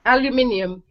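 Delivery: aluminium in a British accent; aluminium, ni sounded clearly. Shown as a demonstration. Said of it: "[name], say".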